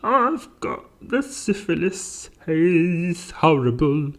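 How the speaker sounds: high, slow comic wail; mock wailing. Joking about the diagnosis, high hammed-up wail. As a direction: lament it in a piercingly high voice.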